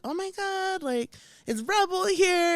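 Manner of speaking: high, breathless fan squeal; high-pitched starstruck skaters; excited fans. Retold or imitated. Skaters excitedly think Rebel arrived.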